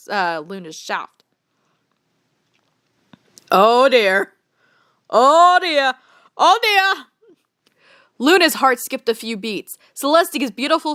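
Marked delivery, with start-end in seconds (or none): 3.5-7.0 loud, rising dismay; mock dismay. Real, not acted. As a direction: say it in growing dismay. Shocked reaction, repeated.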